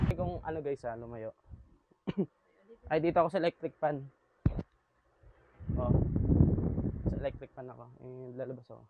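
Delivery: far from the mic; speaking from across the room; distant mic. Real, not acted. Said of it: "Try mo daw lumabas".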